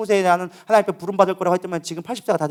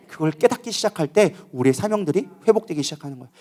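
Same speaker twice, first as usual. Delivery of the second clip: ordinary talk, nothing marked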